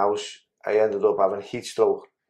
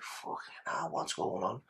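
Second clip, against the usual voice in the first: whispering; 14 dB quieter than usual. Retold or imitated. Inner thought in shock.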